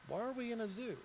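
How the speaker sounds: put-on high, puzzled little kid; young camel voice. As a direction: ask it like an innocent child. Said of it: "She's like".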